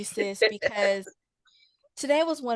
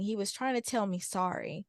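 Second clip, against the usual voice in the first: ordinary delivery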